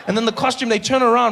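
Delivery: ordinary talk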